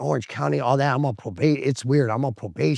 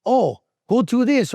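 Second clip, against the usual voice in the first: high, loud, slowed quote; exaggerated urging, pitched way up; mock bad advice; 10 semitones higher, 5 dB louder than usual. Partners who never said such things, mock high-pitched urging. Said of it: "They never said".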